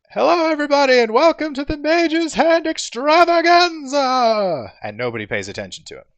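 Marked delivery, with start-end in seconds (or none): 0.1-4.7 unamplified showman announcing; eager host calling to crowd; showman announcer. Acted. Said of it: "Volo hops on stage and says".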